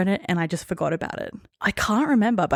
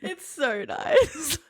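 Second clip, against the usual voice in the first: slow, high, tearful sob of a line; crying; 8 semitones higher, much slower than usual. Real, not acted. Overwhelmed after reading the note, crying. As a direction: let it crack with real tears. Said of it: "I've got tears in both eyes".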